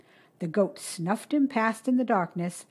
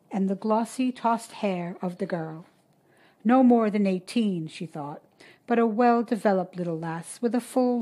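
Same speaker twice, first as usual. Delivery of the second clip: usual speaking voice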